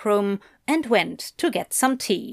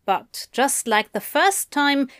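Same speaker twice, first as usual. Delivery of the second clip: plain reading voice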